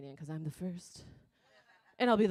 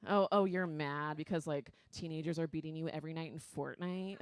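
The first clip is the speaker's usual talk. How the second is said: ordinary talk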